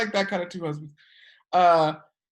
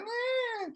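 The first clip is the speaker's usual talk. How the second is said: very high, soft mock child wail; imitated kids' whine; 7 dB quieter than usual. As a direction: squeal like a whining little kid. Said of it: "And they're like".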